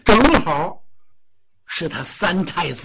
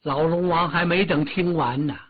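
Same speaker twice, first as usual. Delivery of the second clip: ordinary delivery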